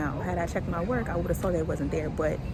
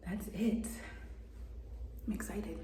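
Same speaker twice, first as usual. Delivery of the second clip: secretive teaser to the audience; whispered tease; 3 semitones higher, 12 dB quieter, much slower than usual. Real, not acted. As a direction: tease the viewers in a breathy hush. Teasing sign-off to viewers.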